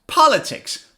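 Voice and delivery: "Politics" in one go, POL high and stressed; quick "Politics", falling from a high first syllable. Shown as a demonstration. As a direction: punch POL in a joined-up "Politics". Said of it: "Now say it faster".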